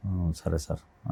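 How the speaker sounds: very soft, slow, timid "okay sir"; timid agreement. Retold or imitated. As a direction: barely dare to agree. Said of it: "బెరుగ్గు బెరుగ్గా వచ్చాడు". (భయంగా చాలా మెల్లగా ఒప్పుకోవడం)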